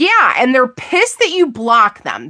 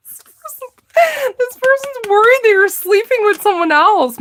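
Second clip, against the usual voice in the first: much higher, stifling laughter; giggly reread; 10 semitones higher than usual. Real, not acted. Tarot reader repeating the card's message through laughter.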